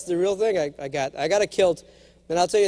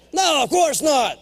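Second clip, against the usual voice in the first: mock indignant shout; 6 semitones higher, 4 dB louder, slower than usual. Mock-confident reply to wife.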